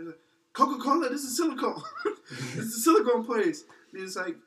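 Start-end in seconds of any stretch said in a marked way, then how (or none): 0.5-3.6 higher, louder, laughing mock yell. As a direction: shout it while laughing at the boss. Boss yells at truck driver.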